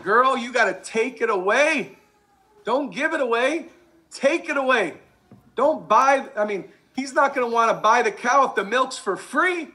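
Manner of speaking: put-on high, insistent girlfriend-to-girlfriend pep talk; telling a friend to hold back, sassy and fired up; emphatic advice. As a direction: urge her hard, sassy and animated. His own retold advice, heated.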